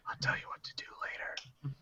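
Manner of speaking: hushed, voiceless secret whisper; very soft, breath-only aside. Acted. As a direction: whisper it privately to someone just below. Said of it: "he's gonna whisper above his head".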